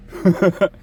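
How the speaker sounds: quick high-pitched laugh. Real, not acted. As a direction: laugh at own joke. Laughs after vote joke.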